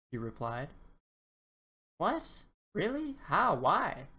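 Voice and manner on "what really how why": high squeaky surprised questions; confused surprise. Acted. Dinosaur stunned by three wishes, high surprised questions. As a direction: pile the questions on in disbelief. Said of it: "who was rather confused".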